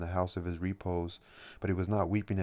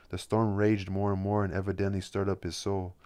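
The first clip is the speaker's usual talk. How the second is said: plain reading voice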